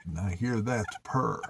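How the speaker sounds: usual speaking voice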